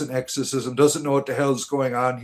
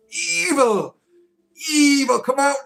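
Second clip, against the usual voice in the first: high, wailing call to the evil; mock summons; 10 semitones higher, slower than usual. Mocking the exorcist, wailing for the evil to leave. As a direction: croon for the evil to come out.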